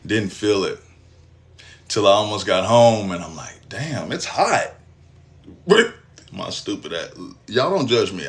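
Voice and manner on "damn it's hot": slow, stunned; dazed slow remark. Retold or imitated. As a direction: drag it out, not yet getting it. Noticing the wound burning.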